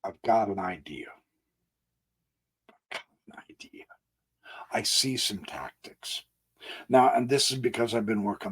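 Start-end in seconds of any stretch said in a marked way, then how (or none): 0.0-1.1 soft conspiratorial whisper; hushed, scheming whisper. Acted. Sudden idea whispered to viewers. 2.7-3.8 barely audible, quick whisper; fading, rushed secret whisper; faint whisper. Acted. Repeats idea fainter, quicker. 4.7-6.2 soft scheming whisper; quiet conspiratorial whisper. Acted.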